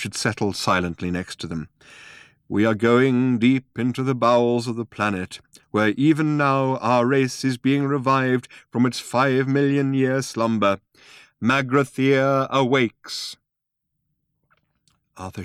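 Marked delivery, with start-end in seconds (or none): 2.5-13.4 put-on high, slow, portentous old man; grand old man. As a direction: announce it like an ancient prophecy.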